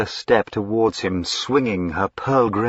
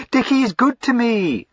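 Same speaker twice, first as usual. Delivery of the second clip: higher, excitable fine lady; eager young lady Lavinia; 5 dB louder than usual. Acted. Lavinia gushing to her brother, high and eager. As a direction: gush up high like a spoilt sister.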